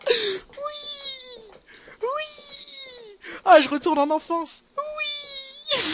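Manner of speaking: high, loud, near-crying cries of joy; overjoyed yelling. Real, not acted. Streamer tells viewers he's back to childhood, overjoyed yelling. Streamer celebrating a huge lucky drop.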